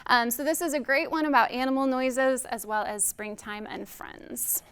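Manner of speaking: plain reading voice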